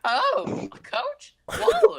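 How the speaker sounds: very high squealed astonishment; hammed-up parody of gushing awe; mock amazed classmate. Friend mocks the gushing classmate.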